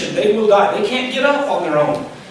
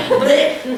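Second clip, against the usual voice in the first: laughing mock bleat, pitched up; sheep bleat; 6 semitones higher than usual.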